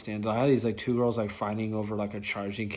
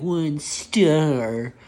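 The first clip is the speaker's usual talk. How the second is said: snide mock rating; 6 semitones higher, 9 dB louder than usual. Repeating girl's mockery.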